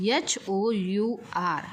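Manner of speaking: एच ओ यू आर spelled letter by letter, very slowly; slow spelling. Shown as a demonstration. Teacher spelling hour for students, very slowly. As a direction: spell out each letter with a pause. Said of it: "आवर".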